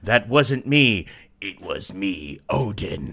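slow, hammed-up confession, sinking low; mock soap reveal. Odin confessing in a soap parody, mock-dramatic.

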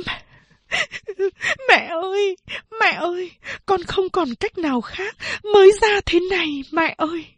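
high-pitched tearful plea; crying while speaking. Acted. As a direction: plead through tears to the dying mother. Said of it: "vừa khóc vừa nói". (vừa khóc vừa nói, giọng cao nghẹn ngào)

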